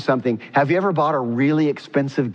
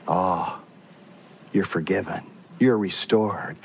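softer, lower, gentle forgiving voice; Jesus tenderly forgiving James, softer; tender comfort; 8 semitones lower, 5 dB quieter than usual. Retold or imitated. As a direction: reassure him softly like a forgiving brother.

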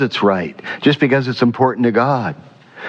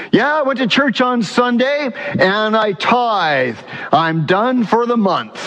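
smug churchgoer; 9 semitones higher than usual. Retold or imitated. Mocking a self-righteous churchgoer.

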